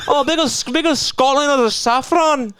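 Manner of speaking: high-pitched mock-Scottish voice; squeaky-high caricature of a Scottish accent. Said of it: "maybe it's big in Scotland".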